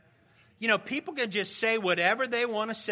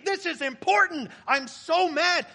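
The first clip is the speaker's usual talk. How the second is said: mock indignation; 7 semitones higher than usual. Acting out energized anger.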